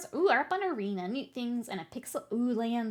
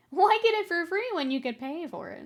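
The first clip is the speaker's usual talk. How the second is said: higher, louder imitation of a pushy pitch; mock sales pitch; 8 semitones higher, 7 dB louder than usual. Game coaxes player to pay, mock sales pitch. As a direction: push it like a mocking salesperson.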